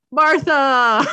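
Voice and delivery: loud, high, hammed-up cry; mock dramatic cry. Mocking how Batman and Superman reconcile, loud mock cry. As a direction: cry out the name theatrically.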